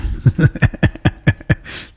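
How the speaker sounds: breathy, voiceless laughing; helpless run of chuckles; laughter. Real, not acted. Host amused by a listener's transfer rumour, chuckling.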